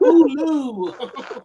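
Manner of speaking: Hulu drawn out Baltimore-style, laughing; mock Baltimore Hulu through laughter; Baltimore accent. Host mimicking Baltimore speech, laughing Baltimore accent. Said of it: "That's how they be in Baltimore".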